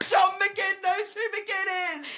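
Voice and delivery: high, slow, delighted disbelief; squealing with excitement; excited. Real, not acted. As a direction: shout it out in giddy delight.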